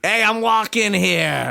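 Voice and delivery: hammed-up New Yorker yelling at a car; New York accent. Joking about the DMV, doing a full New York accent. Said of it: "full New York City".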